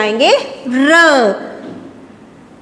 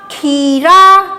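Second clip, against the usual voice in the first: very slow, drawn-out blended word; sounding out words; 4 dB louder, much slower than usual. Shown as a demonstration. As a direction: stretch the word out slowly. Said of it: "अब इसको पढ़ के देखते हैं".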